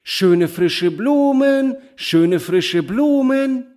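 high, drawn-out street vendor call; bright flower-seller's cry. A narrator's reading. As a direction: sing out your wares, holding the last word high. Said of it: "ihre helle Stimme ertönte". (heller, lang gezogener Ruf einer Blumenverkäuferin)

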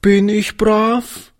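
hohe, langsame, zaghafte Kinderfrage; ängstlich und sehr langsam fragen. A narrator's reading. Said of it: "fragte sie ängstlich". (kleines Mädchen fragt den Alten ängstlich, hoch und langsam)

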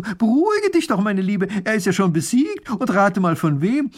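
high, loud, agitated call; calling after. A narrator's reading. Wife stopping the fleeing neighbour, calling out. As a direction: call after someone running off. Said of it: "Mathilde ruft ihr zu".

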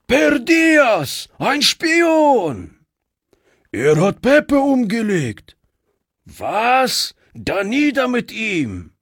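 an angry mob of bandits shouting at a prisoner; angry outcry. Acted. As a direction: hurl it at the captured spy.

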